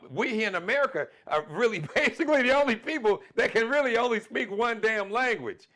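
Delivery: high, loud, amused outburst; loud exasperation. Real, not acted. Speaker ribbing Americans for knowing one language, loud exasperation. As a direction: raise your voice in comic exasperation at your own people.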